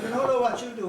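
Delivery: mock exclaim. Quoted friend exclaiming.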